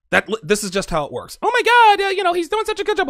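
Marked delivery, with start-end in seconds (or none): 1.4-3.1 high, fast, squealing mock gush; gushing girly mock. Mocking Becca's smitten reaction to Garrett, high gushing voice.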